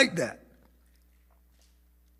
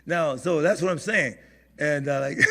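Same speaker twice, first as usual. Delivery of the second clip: ordinary talk, nothing marked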